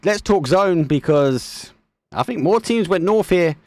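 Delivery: brisk esports commentary, lively and running; live play-by-play. Acted. Caster narrating the final game to viewers, lively play-by-play. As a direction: call the action for the stream.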